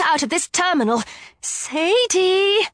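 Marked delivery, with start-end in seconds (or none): 1.4-2.7 urgent rising call of the name; warning. A narrator's reading. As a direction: alert her friend to danger. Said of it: "Liz warned".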